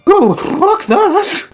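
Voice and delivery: slow, high, wobbly creature cry. Acted. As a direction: let the voice wobble and quaver. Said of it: "You hear a very wobbly voice say".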